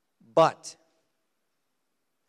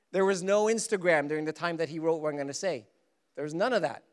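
ordinary talk

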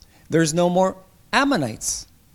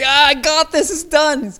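high, loud taunting brag; gloating enemy; 11 semitones higher, 7 dB louder than usual. Retold or imitated. The enemy gloating over God's plan, high and loud. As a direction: crow over a win like the enemy. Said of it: "the enemy's like".

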